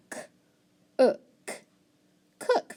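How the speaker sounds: separated k, then ook, then cook; sounding out cook. Shown as a demonstration. Teacher sounding out cook, stressing the oo. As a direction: isolate the oo sound. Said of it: "O O can say oo".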